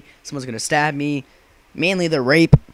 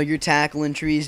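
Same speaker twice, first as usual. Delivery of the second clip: normal talking voice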